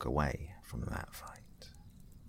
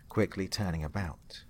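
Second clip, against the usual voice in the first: usual speaking voice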